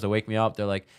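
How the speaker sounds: ordinary talk, nothing marked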